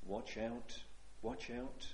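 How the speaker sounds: a low murmured watch out; hushed warning. Acted. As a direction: warn them quietly, close in. A quiet warning voiced.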